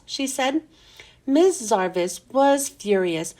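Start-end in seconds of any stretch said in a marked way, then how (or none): none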